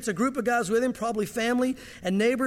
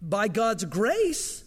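usual speaking voice

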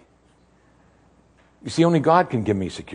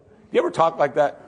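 ordinary delivery

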